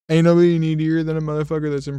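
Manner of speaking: fast, loud exasperated rant. Real, not acted. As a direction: belt it out, fed up. Ranting about the needy dad.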